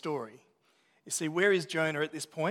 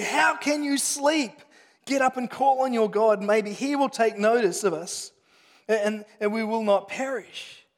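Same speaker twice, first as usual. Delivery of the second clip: raised, urgent voice rousing a sleeper; urgent captain; 6 semitones higher, 8 dB louder than usual. Acted. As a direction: shake him awake with your words. Captain rebukes the sleeping Jonah.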